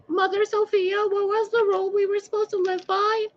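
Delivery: put-on very high, childish squeaky voice asking Mother Sophia; mock dim-witted follower, high and squeaky; squeaky confused human. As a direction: play a clueless squeaky human asking for the rule. Voicing confused humans asking god.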